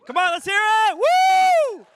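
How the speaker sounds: high, loud whooping shout; crowd hype shout. Real, not acted. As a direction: whip the crowd into cheering. Hyping the crowd to cheer.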